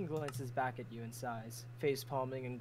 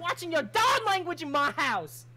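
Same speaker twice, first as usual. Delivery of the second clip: high, loud motherly scold; 8 dB louder than usual. Acted. Crimson scolding a guest for swearing, loud and high. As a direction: tell him off like a strict host.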